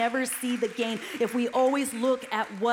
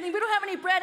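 mock panicked disciple; 7 semitones higher, 4 dB louder, faster than usual. Disciples panicking over bread.

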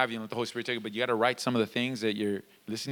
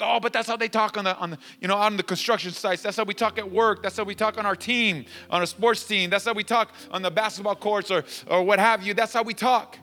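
higher-pitched excuse-making; mock protest; 6 semitones higher than usual. Churchgoer defending cussing to the pastor, mock protest. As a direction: rattle off excuses as an objecting churchgoer.

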